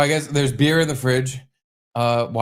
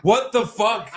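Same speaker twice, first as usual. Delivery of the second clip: retold startle; 8 semitones higher, slower than usual. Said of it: "He scared the shit out of me".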